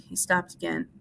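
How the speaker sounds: ordinary delivery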